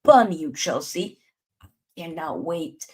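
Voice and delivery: ordinary talk, nothing marked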